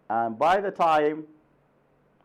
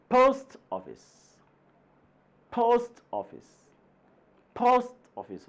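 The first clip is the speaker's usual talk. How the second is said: teacher drills post office stress; 10 semitones higher than usual. Shown as a demonstration. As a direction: punch post, drop office. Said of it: "We stress on the first word".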